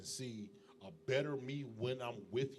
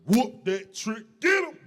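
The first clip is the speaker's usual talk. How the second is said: hype shout; 10 semitones higher, 20 dB louder, slower than usual. Retold or imitated. Chanting [name] lyric hype.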